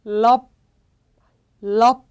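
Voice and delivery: 럽 loud, stopped on a final p; pronunciation demo. Shown as a demonstration. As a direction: model 럽 alone, lips shutting on the p. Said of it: "하고 읽어주시면 됩니다".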